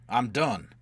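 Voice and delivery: loud admission of defeat; roaring. A narrator's reading. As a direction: call out forcefully that it's over. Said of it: "Quat roared".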